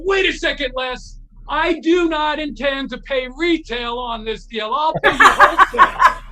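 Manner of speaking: high, loud, emphatic haggling; mock indignation. Guitarist haggles with his idol over shirts, mock indignant.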